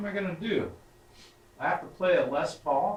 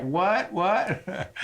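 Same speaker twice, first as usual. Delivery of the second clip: baffled what; 7 semitones higher than usual. Retold or imitated. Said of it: "I'm like".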